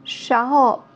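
high, loud desperate cry of a name; pleading wail, near bursting; anguished wail. A narrator's reading. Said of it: "زجه زد".

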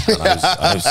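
laughing. Real, not acted. Laughing while recalling being out of place.

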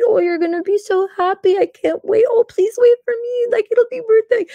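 put-on high, gushing baby-talk voice, like cooing at a puppy; baby talk to a dog. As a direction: coo it as if to a beloved pet. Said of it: "it sounds like he's talking to a dog".

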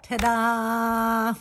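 loud, high ta-da; triumphant. Real, not acted. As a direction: announce the win. Announcing the cure.

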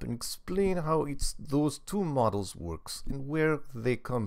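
put-on reading the letter in a light Polish accent; slow, careful Eastern European accent; a hint of Polish English, read slowly. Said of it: "the reason why I slipped in that accent".

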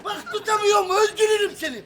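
brother-in-law losing it, shouting threats; furious shout. Acted. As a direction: roar him out of the house.

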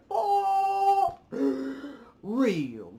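one long, high, loud holler; stretched-out yell; drawn-out exclamation. Real, not acted. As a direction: hold the word loud and high. Emphatic outburst in greeting.

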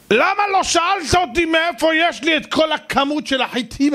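the little ant shouts her reproach; indignant ant. Acted. The ant to King Solomon, shouting in high indignation.

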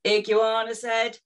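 usual speaking voice

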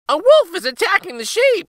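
put-on high, loud boy's cry for help; high-pitched shouted warning; crying out in false alarm. A narrator's reading. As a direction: raise the alarm at the top of a high voice. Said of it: "he cried".

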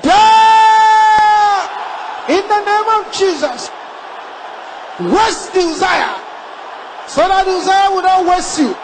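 full-force shouted sermon lines; fiery preaching. Acted. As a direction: preach it hard at the crowd.

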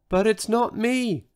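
high, small voice of protest. A narrator's reading. Huge dinosaur denies making the noise, protesting. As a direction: object in a little creature voice. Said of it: "protested Sizo".

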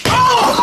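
very high, loud "oh" scream; huge goal roar; celebratory yell. Real, not acted. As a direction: explode as the goal goes in. Celebrating the equaliser.

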